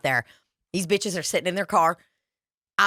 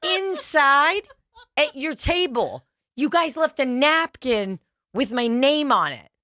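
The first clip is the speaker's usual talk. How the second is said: high, slow syrupy politeness; sweetly drawn-out taunt; fake sweet; 8 semitones higher, slower than usual. [name] taunting the women with the napkin, slow and syrupy. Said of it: "I go".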